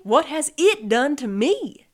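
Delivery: loud, high indignant retort; cross. A narrator's reading. Armadillo stuck in Sloth's chair, cross. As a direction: throw the blame right back, crossly. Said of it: "said Armadillo crossly".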